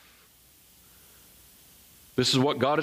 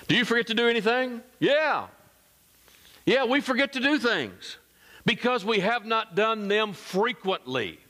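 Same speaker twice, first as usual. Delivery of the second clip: high-pitched, emphatic sermon delivery; animated preaching; 10 semitones higher than usual. Real, not acted. Pastor asking the congregation about forgetting things, animated. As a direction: preach it high, driving the point home.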